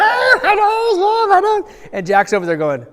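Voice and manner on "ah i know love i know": much higher, put-on hurting voice; lightly voiced whining boy; mock whimper. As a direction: play the injured brother, half-joking. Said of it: "Max is like".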